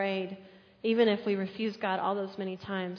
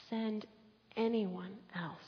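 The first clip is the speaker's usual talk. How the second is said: quiet echo; 8 dB quieter, slower than usual. Shown as a demonstration. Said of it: "send anyone else (repeated)".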